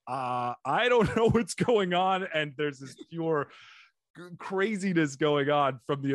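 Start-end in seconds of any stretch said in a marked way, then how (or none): 0.1-2.3 slow, laughing bewilderment; giggly confusion. Retold or imitated. Comedian high on mushrooms, slow, laughing and lost. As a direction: laugh through lost words. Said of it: "where you're like".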